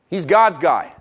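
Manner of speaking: ordinary talk, nothing marked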